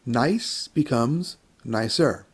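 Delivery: slowed down so each ending is heard; slow, clear model sentence. Shown as a demonstration. Teacher modeling the comparative for students, slow and clear. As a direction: spell it out slowly for students. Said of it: "we put E R at the end".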